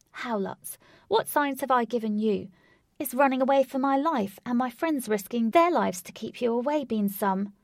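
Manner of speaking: put-on loud, incredulous young woman; heroine arguing. A narrator's reading. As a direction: demand an answer, outraged. Voices heroine Katy arguing.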